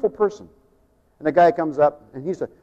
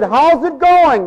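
cheery greeting; 9 semitones higher, 7 dB louder, much slower than usual. Retold or imitated.

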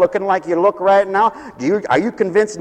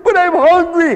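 put-on higher, whining child protesting; whiny kid; 8 semitones higher than usual. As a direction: whine it like a hungry child. Kid complaining back.

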